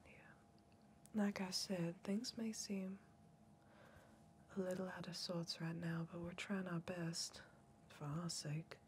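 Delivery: soft unvoiced whisper, close and intimate; hushed soothing whisper; ASMR whisper. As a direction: breathe it like an ASMR video. ASMR-style whispering to victim.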